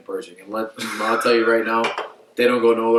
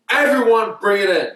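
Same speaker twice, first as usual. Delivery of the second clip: raised, high-pitched call to the team; retold coach's call; 10 semitones higher, 4 dB louder than usual. Coach summoning the whole team to him, retold calling out. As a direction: imitate a coach hollering everyone in.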